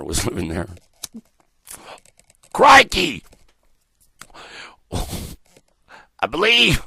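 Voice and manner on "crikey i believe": high, loud crocodile-hunter Australian; bad Australian accent. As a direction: holler it in a hammed-up Australian accent. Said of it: "I can't do an Australian accent".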